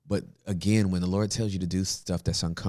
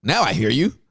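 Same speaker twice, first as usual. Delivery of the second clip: high, loud sudden realization; high and loud mock surprise; mock wake-up exclamation; 7 semitones higher, 6 dB louder than usual. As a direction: act out someone finally getting it. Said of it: "to make them like".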